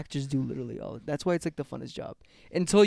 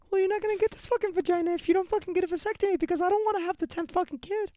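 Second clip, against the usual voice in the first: nagging wife. Acted. Plays nagging wife in improv.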